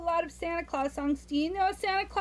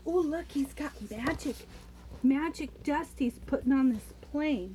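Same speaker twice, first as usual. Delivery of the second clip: ordinary delivery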